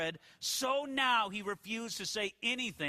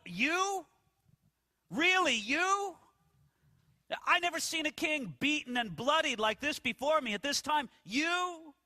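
sarcastic disbelief; 4 semitones higher than usual. Retold or imitated. Pilate scoffing at the beaten Jesus, sarcastic and incredulous. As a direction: sneer the question at a beaten prisoner. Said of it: "sarcastic or ironic in his tone".

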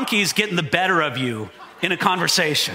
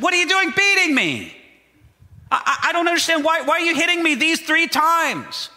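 high, stammering hurt donkey voice; a confused donkey asking why it is beaten; bewildered donkey; 9 semitones higher than usual. Acted. Preacher voicing the beaten donkey's stammering complaint to Balaam, higher.

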